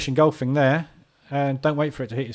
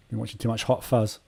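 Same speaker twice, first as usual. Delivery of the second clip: ordinary talk, nothing marked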